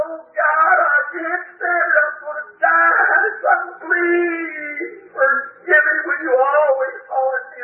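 high, slow sobbing shout; high, slow wailing plea; anguished crying. Retold or imitated. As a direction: cry out in agony, slowly. Retells crying out in agony.

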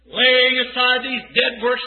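usual speaking voice

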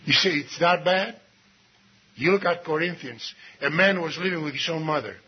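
normal talking voice

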